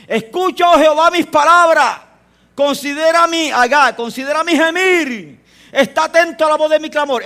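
recitado alto y con fuerza, lento al considerar; salmo clamado. Acted. Predicador lee el salmo clamando. (salmo proclamado en voz alta)

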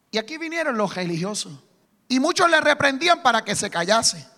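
ordinary talk, nothing marked

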